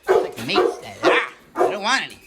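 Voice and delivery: panicked pleading as the dog, loud and high; yelping protests as the dog dodges cologne. Pretend. Dog dodging cologne spray.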